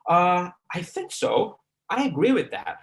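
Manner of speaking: slow "I think so", lively tune on "I agree with that"; exaggerated intonation. Shown as a demonstration. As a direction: draw out "I think so", lift "agree". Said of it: "intonation is something like that".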